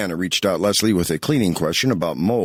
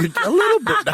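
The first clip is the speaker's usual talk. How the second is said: high laughing through words; faster than usual. Real, not acted. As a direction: laugh it out teasingly. Teasing co-host, laughing.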